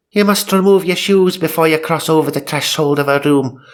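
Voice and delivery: high, fast hammed-up priest warning. Comic reader voices the priest warning the parents. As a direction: ham up the priest's dire warning. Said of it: "he warned".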